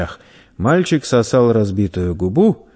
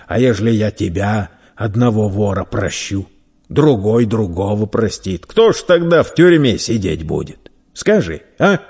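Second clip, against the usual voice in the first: lecturing the young thief in mock amazement; stern, toying merchant; scoffing merchant; 4 dB louder than usual.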